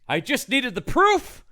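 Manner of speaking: high-pitched hero's declaration; mock Van Helsing.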